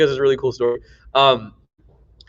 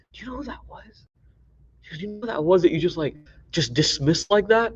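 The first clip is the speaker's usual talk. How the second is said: hushed whisper; 5 semitones higher, 5 dB quieter than usual. Retold or imitated.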